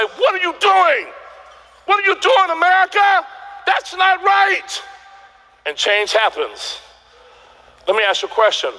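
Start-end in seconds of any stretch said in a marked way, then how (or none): 0.0-4.8 outraged world. Retold or imitated. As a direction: cry out in disbelief at the injustice. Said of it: "the world's gonna say".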